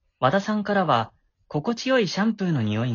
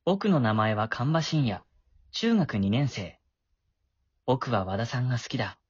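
low, calm first-person narration; story narration; 5 semitones lower than usual. A narrator's reading. Boy narrates his own story. (落ち着いた低めの語り)

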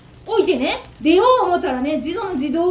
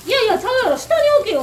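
much higher and louder, snapping at her; rude scolding; 8 semitones higher, 5 dB louder, faster than usual. Retold or imitated. Rude customer telling the owner she got it wrong, mock angry shout. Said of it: "って言うんですね".

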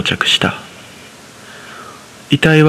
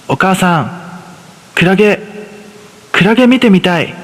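put-on higher, eager child voice; higher-pitched excited young son; little boy voice; 7 semitones higher than usual. A narrator's reading. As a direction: voice a five-year-old begging his mother. Son asks to see jellyfish.

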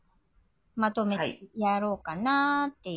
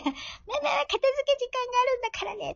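much higher and fast, cute scold; sweet high mom nagging quickly; much faster than usual. Retold or imitated. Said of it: "とか言っちゃって".

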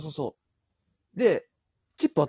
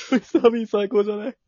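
gushing through laughter, higher and louder; laughing; 8 semitones higher, 3 dB louder than usual. Real, not acted. Telling friends about Mr. Bean, laughing hard while she talks. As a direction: still cracking up at the story. Laughing at her own story.

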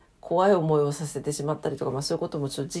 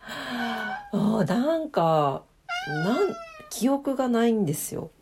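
usual speaking voice

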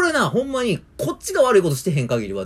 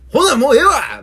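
louder, higher snap; mock angry outburst; 9 semitones higher, 9 dB louder than usual. Old man storming off from the register, huffy shout. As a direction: give up in a huff.

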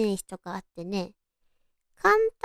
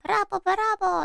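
cheering bravo; 9 semitones higher, much faster than usual. Retold or imitated. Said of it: "ブラボーって言うじゃん".

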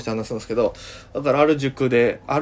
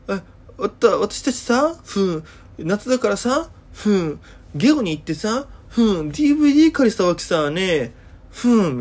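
put-on higher, chatty girl voice with humming fillers; Okinawan girl chatter; 5 semitones higher than usual. Yankee girl gossiping to the teacher, higher with ふーん fillers. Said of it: "ふーんってかましてくるんですよ".

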